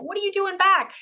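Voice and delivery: high, lively surprised question; catching up with an old friend, surprised. Retold or imitated. Cindy asking her old client, high and surprised.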